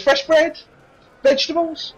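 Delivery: high-pitched, brief prompts; harried serving girl; impatient barmaid. Acted. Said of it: "She goes".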